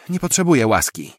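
loud, high, indignant refusal; angry rebuff. A narrator's reading. Passenger refuses the driver's apology, loudly indignant. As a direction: throw the apology back loudly.

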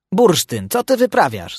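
high, teasing question; scoffing at the other dog; mocking. A narrator's reading. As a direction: ask with a jeer. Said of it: "spytał go drwiąco".

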